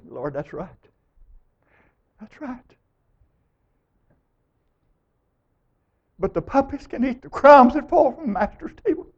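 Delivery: humble soft plea. Retold or imitated. Preacher voicing the Canaanite woman's plea to Jesus, hushed and humble. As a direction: voice the woman begging gently for the crumbs.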